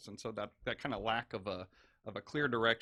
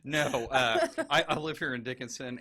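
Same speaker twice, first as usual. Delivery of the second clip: louder, eager candidate on the doorstep; canvassing voice; 3 semitones higher, 10 dB louder than usual. Pretend. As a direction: pitch yourself to a neighbor. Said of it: "This is my door-to-door canvassing voice".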